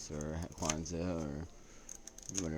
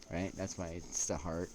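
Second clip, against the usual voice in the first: ordinary talk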